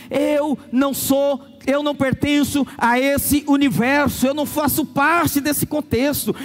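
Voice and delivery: slow, deliberate, each phrase weighed; slow conviction; slow solemn declaration. Acted. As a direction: declare it slowly, set apart from the world. Spirit-convinced believer declaring.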